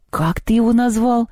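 high, stunned disbelief; astounded question. A narrator's reading. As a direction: ask as if you can't believe your ears. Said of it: "потрясённо спросил Антон".

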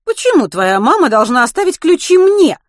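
loud, high-pitched neighbour voice; suspicious. A narrator's reading. Neighbour doubting boy's story about keys, suspicious. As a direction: question the child warily, pitched well up. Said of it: "подозрительно".

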